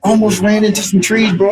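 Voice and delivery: reliving the Bentley's launch, excited; excited retelling. Retold or imitated. Car took off shockingly fast.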